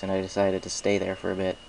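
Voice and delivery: ordinary delivery, nothing marked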